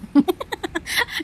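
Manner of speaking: a high breathy laugh burst; high giggle. Real, not acted. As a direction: giggle high at the wrinkled lemons. Laughing at sorry lemons.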